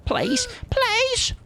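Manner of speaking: very high falsetto "please"; mock-desperate, piping plea; squeaky pleading. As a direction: beg in a small squeal. Begs survivor to use a locker.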